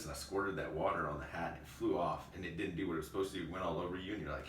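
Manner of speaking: normal talking voice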